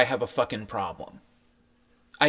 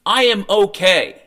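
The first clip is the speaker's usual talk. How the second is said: emphatic rant; 6 semitones higher, 9 dB louder than usual. Real, not acted. Ranting emphatic.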